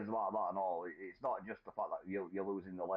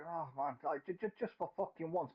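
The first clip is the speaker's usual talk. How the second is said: exasperated plea; 7 semitones higher than usual. Acted. Voicing exasperation at gloomy news.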